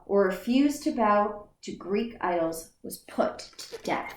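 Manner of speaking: ordinary delivery, nothing marked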